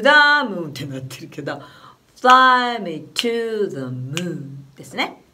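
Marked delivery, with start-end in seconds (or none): 2.1-4.6 slow stretched "fly me to the moon" with long fly and short the; English rhythm demo. Shown as a demonstration. Teacher demonstrating English rhythm on "fly me to the moon", slow and stretched. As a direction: chant it slowly, fly held long. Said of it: "このリズムに乗っけてみる".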